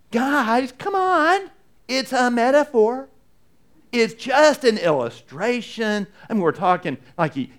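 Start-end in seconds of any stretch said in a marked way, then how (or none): 0.1-6.1 much higher, brushing it off; pretend backpedal. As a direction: laugh it off as just a metaphor. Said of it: "the right answer here is for him to go".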